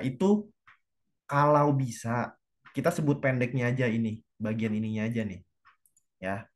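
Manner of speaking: ordinary talk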